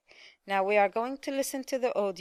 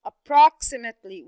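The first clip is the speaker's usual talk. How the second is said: approximately with a loud PROX; stress on second syllable; 7 dB louder than usual. Shown as a demonstration. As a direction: punch "prox" in approximately for the learners. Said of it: "which is the second syllable".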